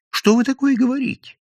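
высокий встревоженный упрёк; одёргивай болтуна, будто донос уже слышат; испуганное шиканье. A narrator's reading. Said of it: "зашикал Евсей Львович". (испуганно шикая, высоким голосом)

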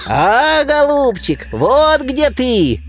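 high squeaking bird voice, drawn out slowly; squeaky beak voice. A narrator's reading. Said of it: "запищал птичий нос". (пискливый птичий голос, нараспев)

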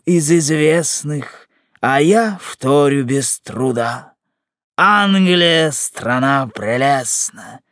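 slow, theatrical poetic recital; stretched, measured rhyme with pauses; verse declamation. Acted. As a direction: declaim with long drawn-out phrases. Loren recites improvised verse.

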